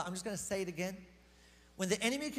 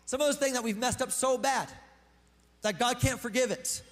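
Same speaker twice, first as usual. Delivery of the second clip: normal talking voice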